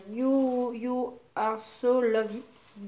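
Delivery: slow, unsure English in a French accent. Real, not acted. Deciphering an English label for viewers, slow French-accented English. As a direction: read the English words haltingly, French accent. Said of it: "Je ne sais pas ce que ça veut dire".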